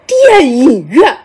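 电影院 stretched slowly as a question; drawn-out echo. Retold or imitated. Audience querying the cinema, long drawn-out 电影院. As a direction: draw out each syllable of 电影院 in mock puzzlement. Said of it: "拉长音还是电影院呀".

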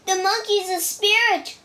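high alarmed cry of a scared robber; frightened cry. A narrator's reading. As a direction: cry out that the monkey is a spirit. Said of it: "cried one man".

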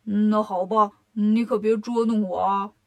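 grudging character voice, low-set; reluctant Bajie. Acted. Pig disciple gives in to his brother, grudging low voice. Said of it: "八戒只好说".

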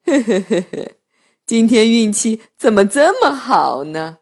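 lightly voiced chuckling wolf; a hint of a giggling wolf; gleeful wolf. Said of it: "笑眯眯地走了".

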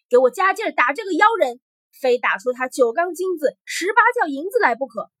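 loud, high, ferocious order; loud command. A narrator's reading. Greedy emperor to his warriors, loudly. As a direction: bellow a fierce demand. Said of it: "大声向武士们说".

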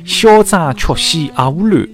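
showy slow Shanghainese recital; Shanghainese recitation. Shown as a demonstration. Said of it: "用上海话讲是更加有味道啦".

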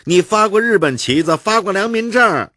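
high, loud squabbling Beijing voices; heated street quarrel. Acted.

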